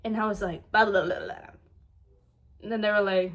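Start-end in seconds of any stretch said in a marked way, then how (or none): 0.7-1.5 babbled tongue-rolling nonsense; imitated mumbling. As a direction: imitate running, garbled mumbles. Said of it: "it was, like, mumbling sounds like that".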